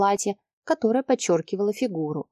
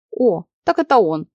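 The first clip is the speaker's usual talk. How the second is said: louder, lightly acted exclamation; an "oh" of delighted discovery; exclaiming; 5 dB louder than usual. A narrator's reading. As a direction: exclaim it with sudden recognition. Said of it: "воскликнула она".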